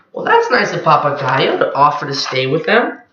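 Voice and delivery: plain reading voice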